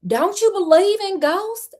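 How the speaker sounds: high, full-voiced Southern belle question; high Alice voice. A narrator's reading. Alice asking about ghosts, high full-voiced question. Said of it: "Oh, let me redo that".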